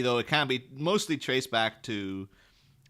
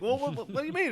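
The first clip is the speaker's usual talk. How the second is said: high, squeaky mock confusion; baffled diner; much faster than usual. Confused diner questioning the chef, pitched high. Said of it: "they're like".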